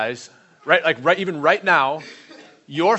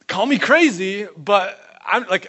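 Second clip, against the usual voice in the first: bold preaching; 4 semitones higher, 5 dB louder than usual. Real, not acted. Preacher building to a claim.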